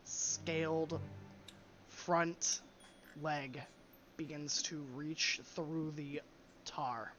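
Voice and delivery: slow, hushed scene setting; game master narration. Acted. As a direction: paint the dragon's arrival slowly. GM describes dragon emerging.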